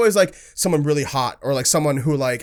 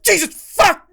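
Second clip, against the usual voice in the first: high, loud startled curse; startled yelp; 6 dB louder than usual. Retold or imitated. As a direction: yelp in shock, laughing. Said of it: "and you're like".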